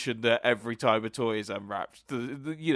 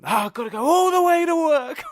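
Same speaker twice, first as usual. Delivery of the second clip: high, loud mock whine with a laugh; 12 dB louder than usual. Co-host quotes Al's favourite line in a loud high mock whine. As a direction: ham up Al's melodramatic complaint. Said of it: "the main one for Al".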